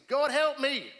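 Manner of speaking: panicked shout of a prayer; desperate cry to God. Retold or imitated. Praying in panic at night.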